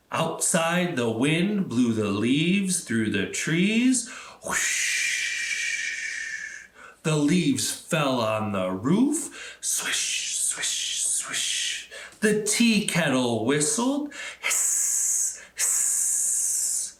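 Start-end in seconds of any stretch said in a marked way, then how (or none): 4.5-6.7 breathy whoosh of wind; soft wind whoosh for kids. A narrator's reading. Wind sound in story. 9.6-11.9 soft fading swishes; whispered leaf sounds. A narrator's reading. Leaves sound in story. 14.4-16.9 hissing like a kettle; soft kettle hissing. A narrator's reading. Kettle sound in story.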